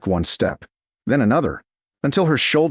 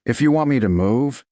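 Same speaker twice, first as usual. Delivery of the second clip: ordinary delivery, nothing marked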